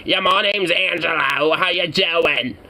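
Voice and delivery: high, put-on Jersey tough-guy voice; Jersey accent. Streamer voices a made-up Angelo in a Jersey accent. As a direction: play a slimy Jersey guy introducing himself. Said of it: "I don't know why she has that accent".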